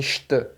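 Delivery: "st" said "sht"; hushed S. Shown as a demonstration. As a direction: make the S of "st" an SH. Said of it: "transformado em st".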